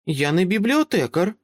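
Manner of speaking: put-on high child voice, calm and grown-up; a small girl who talks like an adult; composed little girl. Child asks Dresden to let them in, composed and high.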